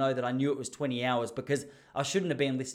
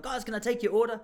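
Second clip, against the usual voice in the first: owner's voice pitched higher; friendly owner asking for the order; imitating the polite owner; restaurant owner; 7 semitones higher than usual. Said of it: "He's like".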